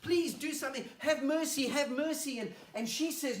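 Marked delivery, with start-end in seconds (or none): 0.0-2.4 loud, high frantic pleading; urgent cries for mercy; desperate begging. Acted. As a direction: beg for your life. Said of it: "he's begging at her".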